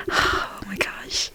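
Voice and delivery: slow, very soft whispered sigh; breathy whisper. Real, not acted. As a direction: exhale it, barely voiced. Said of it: "sighs in disbelief".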